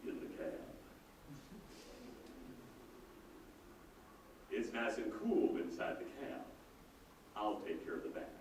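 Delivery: very low, quiet Texan voice; deep Texan accent. Retold or imitated. Cab driver greeting his passenger in a deep Texan voice. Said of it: "he says in this deep Texan accented voice".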